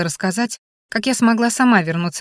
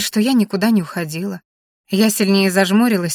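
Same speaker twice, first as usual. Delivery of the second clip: usual speaking voice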